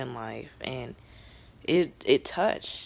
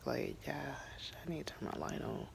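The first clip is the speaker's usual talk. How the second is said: hushed spooky whisper; playful whisper; 12 dB quieter than usual. Pretend. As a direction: whisper it like the lights went out. Joking the show got too dark.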